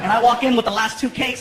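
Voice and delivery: ordinary talk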